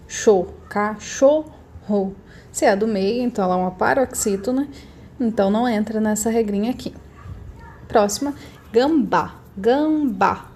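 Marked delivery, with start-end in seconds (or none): 0.1-2.1 CHO alone, then cachorro slowly split up; stress on middle syllable. Shown as a demonstration. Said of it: "é a sílaba do meio". 8.7-10.5 gambá slowly split, open BÁ stressed; stress on last syllable. Shown as a demonstration. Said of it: "Sílaba mais forte".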